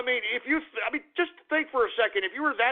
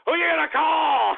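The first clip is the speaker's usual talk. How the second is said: taunting shout; 6 semitones higher, 4 dB louder than usual. Acted. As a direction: shout it higher and louder like a movie catchphrase. Ghostbusters line taunt.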